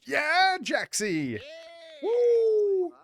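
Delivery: shrieking praise for the puppy; excited cheer. Real, not acted. Cheering the dog's trick.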